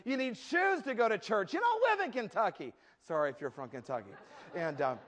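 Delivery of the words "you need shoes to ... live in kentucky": high, raised mother's voice; exasperated scolding. Acted. Mom to Sally about shoes for church, exasperated scolding. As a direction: scold a child in comic exasperation.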